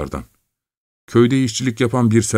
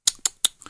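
tongue clicks; 6 dB quieter than usual. Acted. Şakro clicks his tongue disapprovingly.

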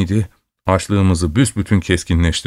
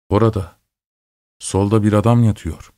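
plain reading voice

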